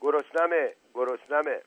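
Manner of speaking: restrained storyteller's shout; narrated shout. A narrator's reading. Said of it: "داد زد".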